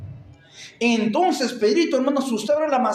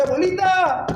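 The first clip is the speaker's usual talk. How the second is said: high-pitched plaintive call; mock tearful cry; 7 semitones higher than usual. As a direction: play a boy calling out to his grandmother. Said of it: "llorando él".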